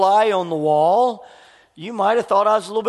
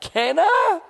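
excited son; 10 semitones higher than usual. Retold or imitated. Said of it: "He's like".

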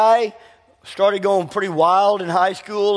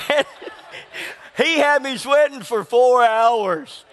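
laughing recollection; 6 semitones higher, slower than usual. Real, not acted. Laughing retelling.

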